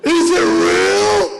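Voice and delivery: high, slow, shouted question; excited shout. Retold or imitated. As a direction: shout it like an amazed fan. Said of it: "People will shout out".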